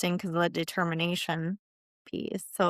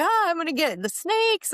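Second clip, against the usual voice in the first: mock panic; 10 semitones higher than usual. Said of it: "'Cause now I'm like".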